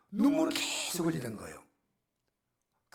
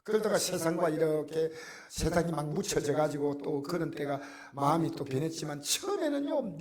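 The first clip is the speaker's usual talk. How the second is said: ordinary delivery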